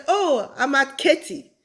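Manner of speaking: Katy with a crisp T, "KEH-tee"; Nigerian pronunciation. Retold or imitated. Newcomer naming her Texas city, Katy as "keh-tee". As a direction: hit the T in Katy. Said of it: "And me, I'll say".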